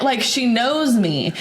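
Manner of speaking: ordinary talk, nothing marked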